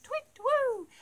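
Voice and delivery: high falling owl call; very high twit-woo, sliding down; owl hoot. Shown as a demonstration. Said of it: "They hoot".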